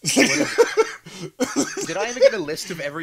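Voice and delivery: high, loud laughing question. Real, not acted. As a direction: squeal it out through laughter.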